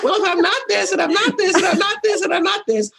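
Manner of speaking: high, laughing run of reasoning; laughing realization. Retold or imitated. Telling the host her kitchen epiphany, high and laughing. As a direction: giggle through the list, voice way up.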